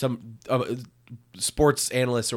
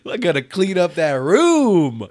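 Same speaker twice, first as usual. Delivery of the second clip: high, louder goofy voice; silly mock voice; 6 semitones higher, 6 dB louder than usual. Friend joking a Messi catchphrase in a goofy voice.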